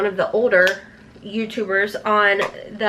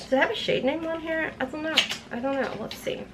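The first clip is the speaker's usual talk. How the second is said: muttering to herself; 3 semitones higher, 9 dB quieter, faster than usual. Real, not acted. Muttering to herself reading packaging.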